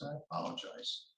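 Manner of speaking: barely voiced, voice giving out; losing voice. Real, not acted. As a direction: voice gone to a whisper. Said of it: "And I'm losing my voice".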